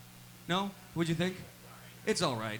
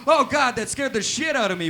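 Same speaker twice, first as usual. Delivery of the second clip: mock-scared shout, raised pitch; startled outburst; 4 semitones higher, 5 dB louder than usual. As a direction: cry out just after a fright. Mourner scared by the posed corpse.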